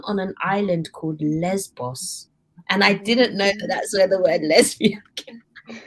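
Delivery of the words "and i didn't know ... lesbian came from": barely holding back a laugh; trying not to laugh as the words come out; giggly confession. Real, not acted. Embarrassed to a podcast co-host, half-laughing. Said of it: "I'm sorry, I should laugh".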